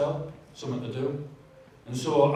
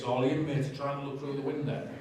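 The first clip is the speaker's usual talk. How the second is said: ordinary talk, nothing marked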